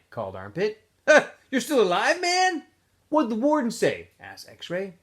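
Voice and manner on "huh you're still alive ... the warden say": put-on high, loud teen voices; boys' voices. A narrator's reading. Campers shouting questions at Stanley, high and loud. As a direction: call out like rowdy campers.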